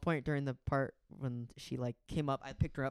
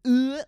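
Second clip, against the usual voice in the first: loud high mock ugh; the groan he always gives; disgusted groan; 12 semitones higher, 16 dB louder than usual. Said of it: "expecting him to go".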